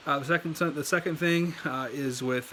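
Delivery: ordinary talk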